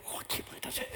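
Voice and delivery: breathless whispered thrill; giddy whisper. Pretend. Boy Samuel touches the altar, giddy whisper. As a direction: whisper it like a kid who broke a rule.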